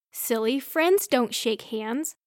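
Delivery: plain reading voice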